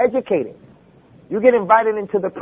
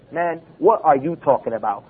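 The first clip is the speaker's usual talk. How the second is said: lower, carefully worded mock-educated question; over-proper challenge; 5 semitones lower, 3 dB quieter than usual. Shown as a demonstration. Preacher showing the needlessly proper way to object, over-proper. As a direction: spell out every word, stiffly correct. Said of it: "We don't have to sit there and say".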